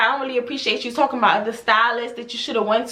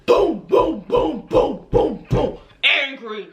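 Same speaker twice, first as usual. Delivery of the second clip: heavy, spaced-out mock footfalls; imitated angry stomping; much slower than usual. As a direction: boom out each stomp up the stairs. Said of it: "She literally walks up the stairs, like".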